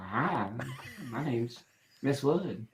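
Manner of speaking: lightly imitated Southern mom; soft, low Southern accent, imitating Mom. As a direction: give a quiet hint of Mom's Southern accent. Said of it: "my mom has the Southern accent".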